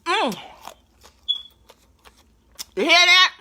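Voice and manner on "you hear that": loud high delighted; excited. Real, not acted. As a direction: shout it with delight. After crunchy bite.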